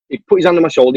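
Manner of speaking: normal talking voice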